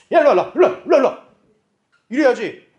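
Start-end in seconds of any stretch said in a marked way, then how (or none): 0.1-1.2 louder, higher calls to the stray animal; herding call. Retold or imitated. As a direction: call the livestock back in. 가축을 몰며 부름.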